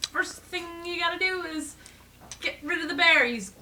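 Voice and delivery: high, loud mole expert voice; storybook mole. Retold or imitated. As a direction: put on an emphatic, high-pitched know-it-all mole. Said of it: "this mole comes up from the ground".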